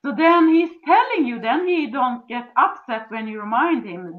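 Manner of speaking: ordinary talk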